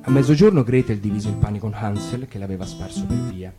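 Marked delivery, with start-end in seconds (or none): none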